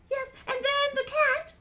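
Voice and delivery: high puppet voice, counting off; Mimi. Acted. Mimi recounts the guests, high puppet voice. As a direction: recite as a tiny character.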